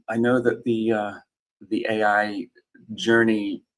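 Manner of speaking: usual speaking voice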